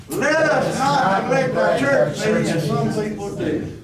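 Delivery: slow, loud, high leading line; slow call-and-response reading. Shown as a demonstration. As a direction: read it for the church to echo. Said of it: "Let's do that one again".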